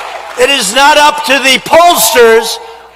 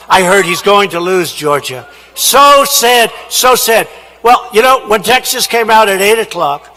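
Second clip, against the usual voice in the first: normal talking voice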